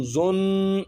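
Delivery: زن with the نون hummed through the nose; زن, humming nasal ghunna; nasalized ghunna. Shown as a demonstration. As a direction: hold the nasal sound in زن. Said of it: "یہاں پر اب غنہ ہو رہا ہے".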